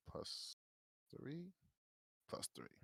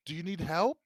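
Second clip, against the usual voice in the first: raised voice, pitched well up; loud eager offer; 7 semitones higher, 13 dB louder than usual. Acted. Adler offering his dagger to the frantic man, loud eager offer. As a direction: call it out high and loud.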